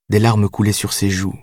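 normal talking voice

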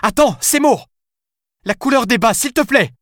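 high yelling plea. A narrator's reading. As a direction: shout after him before he hangs up. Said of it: "hurla-t-elle". (Alice hurle au téléphone)

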